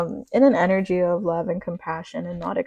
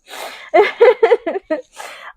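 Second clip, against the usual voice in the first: teary high laugh; laughing through emotion; 14 semitones higher, 9 dB louder than usual. Real, not acted.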